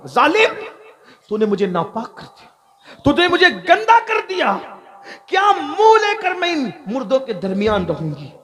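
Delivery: high shrieking accusation; screaming girl. Acted. The dead girl screaming at the thief, high and shrill. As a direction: shriek at him for defiling her. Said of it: "उसने चीख मारी".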